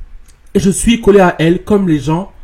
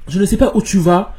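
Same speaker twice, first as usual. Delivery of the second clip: normal talking voice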